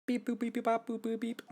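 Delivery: high monotone beeps and boops; robotic beeping speech. Acted. Singer talking in an unknown language, high monotone beeping. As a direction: beep and boop steadily on one high note.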